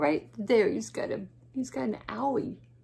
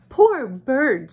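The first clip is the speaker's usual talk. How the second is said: rabbit voice; 3 semitones higher, 10 dB louder than usual. Acted.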